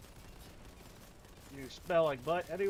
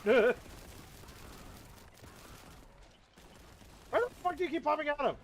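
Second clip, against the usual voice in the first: frustrated yell; 11 semitones higher than usual. Real, not acted. Gamer yelling at a popping-up enemy, frustrated. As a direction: yell it out, fed up.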